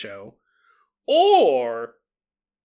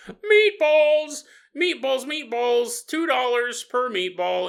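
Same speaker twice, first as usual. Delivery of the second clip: hawking meatball vendor; 4 semitones higher, slower than usual. Acted. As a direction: bellow out the meatballs like a cart seller. Food cart vendor calling out.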